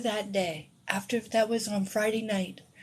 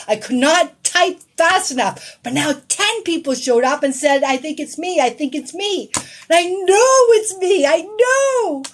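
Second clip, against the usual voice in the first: high, loud and insistent; frantic excitement; 8 semitones higher, 9 dB louder than usual. Retold or imitated. Viewer frantically typing that the message is hers, frantic excitement. Retelling her frantic certainty.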